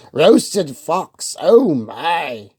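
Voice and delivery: a fox playfully startled; alarmed fox. Acted.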